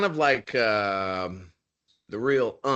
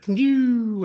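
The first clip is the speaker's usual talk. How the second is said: a high, loud "pew" like a shot whizzing by; imitated shot sound; 6 dB louder than usual. Soldier recalling a shot whizzing past, high mock "pew". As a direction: spit out a quick mock gunshot "pew".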